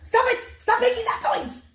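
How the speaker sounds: high, loud furious yell; a mad king shouting at his servants; screaming king. Acted. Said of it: "he starts screaming at them".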